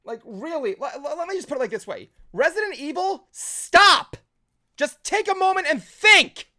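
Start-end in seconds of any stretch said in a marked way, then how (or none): 2.3-6.5 high, loud, punched commands; raised voice, hammering "stop" and "think"; exasperated plea. Real, not acted. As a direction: shout it as an order to the franchise. Pleading rant addressed to franchise.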